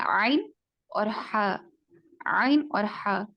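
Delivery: عین اور ہا slowed, squeezed from the mid throat; throat letter demo. Shown as a demonstration. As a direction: articulate عین and ہا from the middle of the throat. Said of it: "درمیان والے حلق سے ادا ہونے والے حروف".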